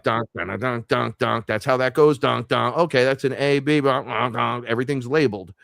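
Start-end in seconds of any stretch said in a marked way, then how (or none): 0.0-1.5 rhythmic dunk-dunk chord hits; imitated piano chords. Playing along to a tutorial, vocalized chord hits. 2.2-2.8 rhythmic dunk-dunk chord hits; imitated piano chords. Playing along to a tutorial, vocalized chord hits. 3.8-4.6 rhythmic bunk-bunk chord hits; imitated piano chords. Working out labeled chords, vocalized chord hits.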